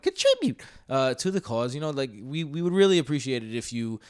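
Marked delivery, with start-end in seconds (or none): 0.0-1.7 Contribute jumps way up in a squeaky falsetto, then drops to normal; silly high squeak. Shown as a demonstration. Host repeating his pitch to listeners, squeaky high Contribute. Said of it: "I went".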